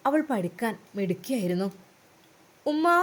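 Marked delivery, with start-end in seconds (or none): 2.7-3.0 long drawn call. A narrator's reading. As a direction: stretch the call out across the house. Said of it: "അവൾ നീട്ടി വിളിച്ചു". (നീട്ടി വിളിക്കുന്നു)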